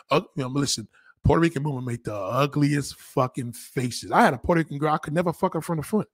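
ordinary talk

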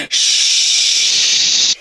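long unvoiced shh; shh exhale. Shown as a demonstration. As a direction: breathe out through teeth. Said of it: "Hear the sound".

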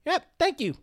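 breezy fake-polite goodbye; mock dismissal. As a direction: wave her out the door. Acting dismissing woman.

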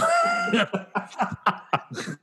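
high, softening giggle fit; real laughter. Real, not acted. Ranter laughing at a friend's interruption, real laughter. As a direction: laugh it out before carrying on.

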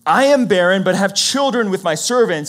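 a barren wife pressing her demand; Rachel's plea. Retold or imitated. Rachel tells Jacob to take her servant, loudly. Rachel gives maidservant, voiced quote.

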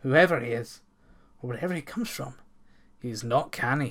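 plain reading voice

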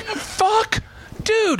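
very high-pitched exclamation; mock panicked cry. Captive losing his rescuer, comically panicked. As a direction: squeal it in acted dismay. Retold dismay as rescuer is tackled.

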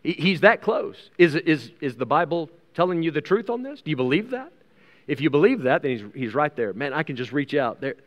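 ordinary talk, nothing marked

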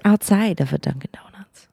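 hushed dramatic whisper. Acted. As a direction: whisper it like a secret. Gasp, then whispered correction.

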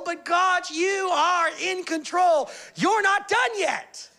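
high, loud, slow proclamation; preaching climax. Real, not acted. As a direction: declare it to God with full force. Preacher declares faith, climax.

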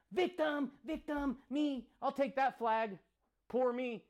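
high mock self-pitying whine; mocking victim whine. Mocking people who run to the victim flag, high mock whine.

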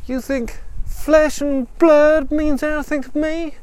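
high, slow scornful character voice; defiant gunslinger daughter. Acted. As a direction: spell out your contempt slowly and high.

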